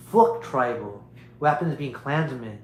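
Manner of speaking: ordinary delivery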